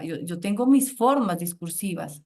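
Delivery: usual speaking voice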